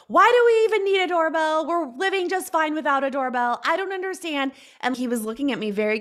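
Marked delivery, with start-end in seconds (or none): 0.1-4.5 high, loud exasperated protest; upset outburst. Retold or imitated. Wife protesting to husband about the doorbell, loud and high. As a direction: throw the question at him in a fluster. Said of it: "And I was like".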